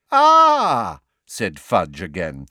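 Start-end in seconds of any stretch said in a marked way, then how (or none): 0.1-1.0 loud, high, gloating exclamation; smirking. A narrator's reading. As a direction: pounce on it with an unpleasant smirk. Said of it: "smirking unpleasantly".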